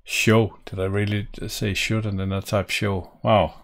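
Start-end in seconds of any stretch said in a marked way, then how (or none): none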